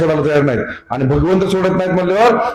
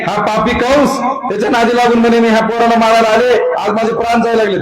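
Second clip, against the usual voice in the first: cursing Kansa; 6 semitones higher than usual. Acted. Dying Putana cursing Kansa, raised and bitter. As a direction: spit out blame at Kansa. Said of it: "शिव्या द्यायला लागली".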